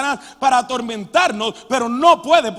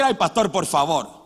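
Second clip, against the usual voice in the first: sceptical scoff; 8 semitones lower than usual. Retold or imitated. Voicing a sceptical listener.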